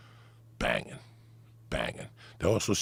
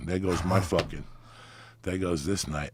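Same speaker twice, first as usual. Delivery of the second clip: usual speaking voice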